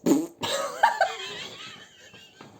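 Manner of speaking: very high shrieking cackle of a laugh. Real, not acted. Laughing at her own prank message, high shriek. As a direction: let out a squealing fit of laughter.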